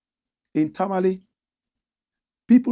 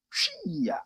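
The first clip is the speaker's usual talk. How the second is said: mock scornful whisper; 6 dB quieter than usual.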